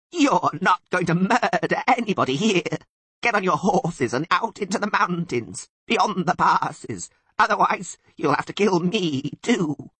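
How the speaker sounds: high, loud, defiant Sylvan. A narrator's reading. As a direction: bleat a stubborn challenge at the elf. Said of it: "bleated even louder".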